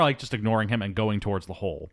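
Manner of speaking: normal talking voice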